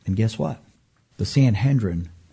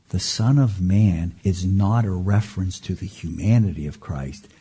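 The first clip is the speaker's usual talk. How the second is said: ordinary talk, nothing marked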